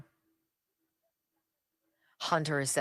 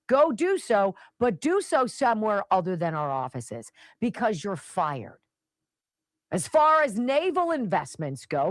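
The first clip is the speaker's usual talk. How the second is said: punchy scripted commentary read, higher and louder on key phrases; radio political commentary; 4 semitones higher, 4 dB louder than usual. Acted. Host lecturing listeners on national security, emphatic commentary. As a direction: deliver it like an emphatic radio monologue.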